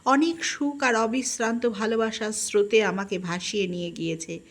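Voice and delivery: usual speaking voice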